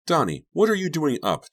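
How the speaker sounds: put-on high, indignant babysitter's demand. A narrator's reading. Jenny catches Donnie out of bed, high demanding tone. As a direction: demand an answer from a child. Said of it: "Jenny demanded".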